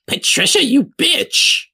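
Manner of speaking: very high-pitched accusing outcry; indignant hag Marcia. Acted. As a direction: round on a fellow witch in shrill outrage. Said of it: "Marcia's just like".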